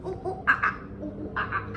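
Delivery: short, playful monkey hoots; monkey chatter. Shown as a demonstration. Teacher showing how monkey goes, playful hooting. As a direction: hoot like a monkey.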